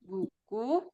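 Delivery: barely audible, voice faint; too quiet. Real, not acted. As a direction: speak so softly it barely carries. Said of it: "Suaranya kecil banget".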